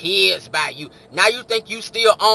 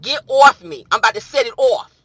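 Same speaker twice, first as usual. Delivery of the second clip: loud, high-pitched New York threat; New York accent; 4 semitones higher, 7 dB louder than usual. Acted. Imitating New Yorkers in the chat, loud New York accent. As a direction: warn him like a riled New Yorker. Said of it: "Ain't that how y'all talk".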